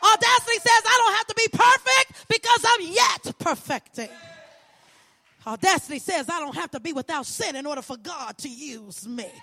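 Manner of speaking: fervent preacher's cadence, rising and falling; preaching. Acted. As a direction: preach it to the congregation with conviction.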